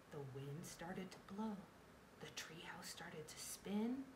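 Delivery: soft, breathy storytelling; hushed suspense. A narrator's reading. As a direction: drop to a hush as magic begins. Hushed build-up to magic spin.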